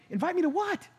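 high, startled question to God; blurting out in disbelief; baffled outburst. Retold or imitated. In the car, puzzled by God's nudge, startled high question. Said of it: "I said it audibly in the car".

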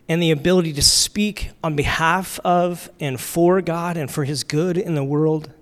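ordinary delivery

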